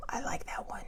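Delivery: very soft, voiceless whisper; hushed approving comment under the breath; whispered aside. Real, not acted.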